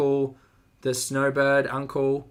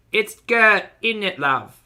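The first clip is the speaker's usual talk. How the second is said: "It's Gert, isn't it, love?" in a loud, higher mock British accent; 7 semitones higher, 6 dB louder, slower than usual. Host tries British pronunciation on a viewer: loud mock British. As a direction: play a chummy Brit, loud and bright. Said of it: "I like the British one a little bit better".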